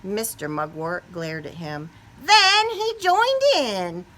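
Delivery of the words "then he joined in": playful surprise. A narrator's reading. Principal joins in surprisingly.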